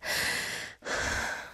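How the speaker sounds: long breathy exhale, no voice; demonstrated heavy breathing. Shown as a demonstration. DJ showing what she does during songs, winded exhale. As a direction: show how you catch your breath off mic. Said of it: "this is what I would do".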